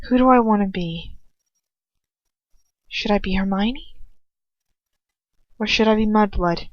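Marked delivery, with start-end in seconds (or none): none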